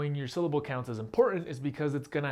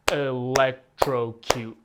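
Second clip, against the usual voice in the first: electrocute slowly, louder, syllable by syllable; slow syllable split; 5 dB louder, much slower than usual. Shown as a demonstration. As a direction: electrocute broken into four slow beats. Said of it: "you clap for each sound".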